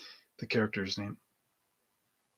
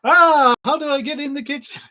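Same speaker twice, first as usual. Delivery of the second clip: high, loud flustered cry; a waiter bumping into a wall, frantic; panicked waiter; 14 semitones higher, 9 dB louder than usual. Retold or imitated. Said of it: "he's, like, on the wall going".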